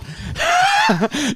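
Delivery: high shrieking laugh; loud burst of laughter. Real, not acted. Laughing at himself.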